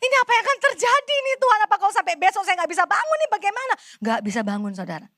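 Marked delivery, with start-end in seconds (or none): 0.1-3.7 fast, high, anxious plea on the verge of tears; quick desperate cry to God, voice pitched up; near tears prayer. Retold or imitated. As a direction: pray to God close to crying. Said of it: "Sampai saya udah mau nangis".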